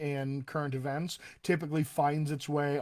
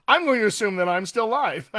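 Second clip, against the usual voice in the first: louder, higher, through a laugh; 4 semitones higher, 6 dB louder than usual. Real, not acted. Host tells viewers the stream may still be live, laughing. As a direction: laugh it off while talking.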